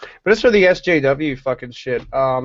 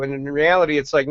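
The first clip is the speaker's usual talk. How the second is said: usual speaking voice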